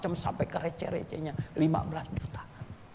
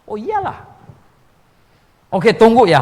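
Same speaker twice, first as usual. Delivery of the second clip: excited agreement; 10 semitones higher, 16 dB louder than usual. Retold or imitated. Agreeing eagerly, loudly.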